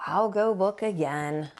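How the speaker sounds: glum, sighing consent; resigned sigh. A narrator's reading. Franklin agrees to search for his tree, resigned. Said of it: "he sighed".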